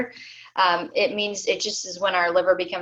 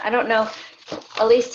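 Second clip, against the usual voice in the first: usual speaking voice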